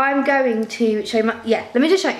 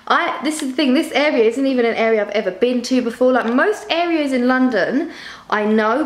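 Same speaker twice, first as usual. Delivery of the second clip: ordinary talk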